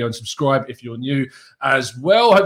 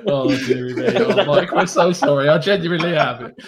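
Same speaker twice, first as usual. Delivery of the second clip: laughing through the words; 4 semitones higher than usual. Real, not acted. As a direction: still cracking up while apologising. Host laughing at guest's joke.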